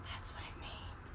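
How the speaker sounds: breathy whispered realisation. Retold or imitated. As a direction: whisper it like a sudden discovery. Sudden realisation heard as a thought.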